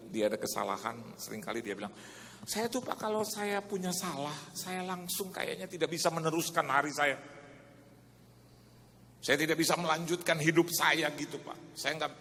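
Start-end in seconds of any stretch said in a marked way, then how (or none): 2.5-12.1 louder, higher and building, like preaching; loud fervent retelling. Retold or imitated. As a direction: push it up with rising fervour. Retold man, preaching build. (suara keras dan makin bersemangat)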